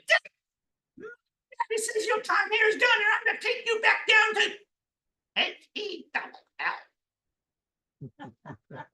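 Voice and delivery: very high, squeaky little devil voice; little demon. A narrator's reading. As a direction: pipe up loudly as a tiny demon. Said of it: "This little demon came in".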